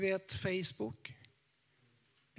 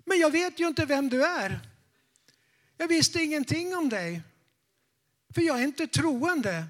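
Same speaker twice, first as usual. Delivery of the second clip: higher and louder, insistent; emphatic old lady; 7 semitones higher, 6 dB louder than usual. Retold or imitated. Old lady insisting she is not a believer, loud and high. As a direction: push back, loud and high.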